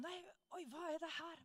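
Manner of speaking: mock astonishment. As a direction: sound caught off guard by what is happening to you. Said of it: "han var helt sjokka".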